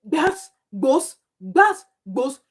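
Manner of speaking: high, loud, punchy bursts; mimicked barking. Vlogger mocking how the interviewee was barking, in loud bursts. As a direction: bark it out in mockery of her. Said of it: "The way she was backing".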